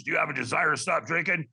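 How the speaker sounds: lightly voiced tough smoker; a hint of the smoker who screens callers; mildly imitated no-nonsense phone volunteer; Madge. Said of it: "she talked like that because she probably smoked".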